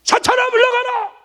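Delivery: high, loud rebuke of Satan; preacher's demo shout to the congregation; commanding shout. Shown as a demonstration. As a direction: cast him out with full force. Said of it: "여러분들도 한번 해보세요".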